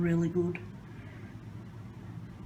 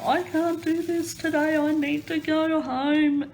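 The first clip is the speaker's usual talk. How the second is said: upset plea; 9 semitones higher than usual. Retold or imitated. Walking in to work, she begs the boss to go home, high and pleading. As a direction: imitate her past self begging to leave. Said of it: "I'd go".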